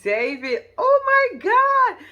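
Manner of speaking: high, drawn-out gushing; mock excitement. Recapping Kenya getting to meet David, mock excitement. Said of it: "Kenya's so damn excited".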